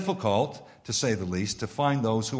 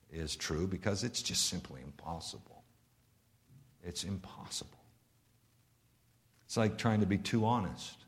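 quiet; 3 semitones lower, 10 dB quieter than usual. Real, not acted. Preacher drops to hushed reflection.

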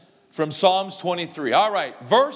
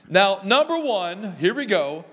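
ordinary talk

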